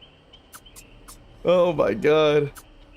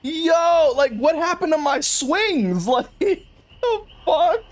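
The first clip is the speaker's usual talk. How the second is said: laughing disbelief; 10 semitones higher, much slower than usual. Real, not acted. Streamer at missing swings.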